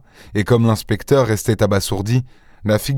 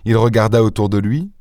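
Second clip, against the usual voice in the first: plain reading voice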